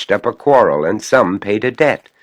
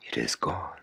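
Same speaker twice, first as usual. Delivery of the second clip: soft hush; 4 semitones lower, 13 dB quieter, faster than usual. A narrator's reading. Soft voiced echo line.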